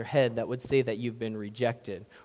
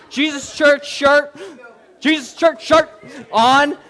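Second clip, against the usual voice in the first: loud, high mock-bragging believer; hammed-up smug braggart; boastful churchgoer; 9 semitones higher, 14 dB louder than usual. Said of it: "walk in here and say".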